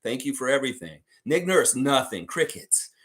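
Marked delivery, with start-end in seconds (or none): 2.3-2.9 breathy whisper of the word Crickets. Acted. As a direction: say Crickets under your breath. Repeated word, two ways.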